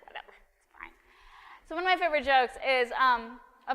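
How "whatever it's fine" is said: muttered whisper to self; a whispered shrug at the congregation; whispered aside. Real, not acted. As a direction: throw it away under the breath.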